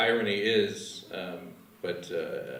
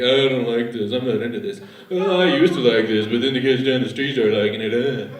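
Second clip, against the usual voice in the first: louder, mock-whiny hipster complaint; 6 dB louder than usual. Hipsters complaining during sex, mock whiny. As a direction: sneer it like a jaded hipster. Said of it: "skinny hipsters bumped up against each other like".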